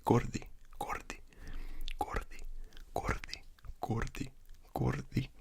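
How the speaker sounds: whispered Gordi with a soft Spanish d. Shown as a demonstration.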